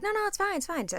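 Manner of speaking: high-pitched, imitated brushing-off; retold insisting all is fine while upset; mock tearful reassurance, pitched well up. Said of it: "I'm crying".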